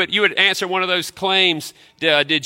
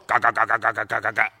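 mock grumbling; 5 semitones lower, faster than usual. Pastor acting out complaining over extra load, low grumble. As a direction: sulk it under your breath. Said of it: "We start grumbling".

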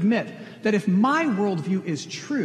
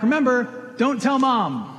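louder, higher playful call; dad yelling back; 6 semitones higher, 5 dB louder, slower than usual. Retold or imitated. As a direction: shout a cheeky secret from the crane. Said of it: "he'd yell back".

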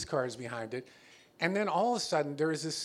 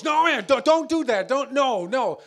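loud, high, panicky pleading; frantic refusal; 9 semitones higher, 12 dB louder than usual. Retold or imitated. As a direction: beg him not to call, loud and high. Panicked friend refusing ambulance.

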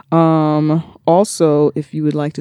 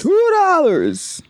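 high echo; 11 semitones higher, much slower than usual. Pretend. Echoing, high and slow.

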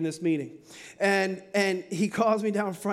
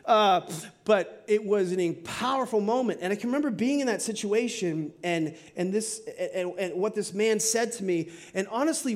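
normal talking voice

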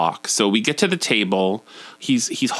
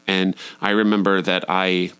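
ordinary talk, nothing marked

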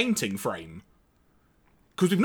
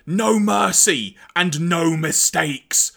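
slow, louder villain decree; Galvatron villain line; 5 dB louder, much slower than usual. Retold or imitated. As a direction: spell it out like a menacing tyrant. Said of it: "Galvatron having a great quote".

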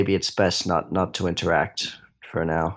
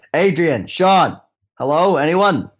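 loud, higher call for friends; 9 semitones higher, 6 dB louder than usual. Acted. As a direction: shout for your friends down the hall. Calling for friends in house.

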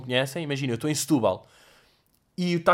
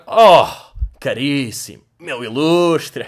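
fake hearty greeting; 8 dB louder, much slower than usual. Father faking he knows someone.

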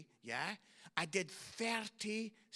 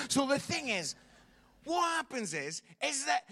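ordinary talk

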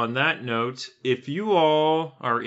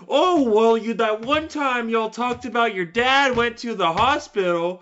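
mock fan; 9 semitones higher than usual. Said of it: "and being like".

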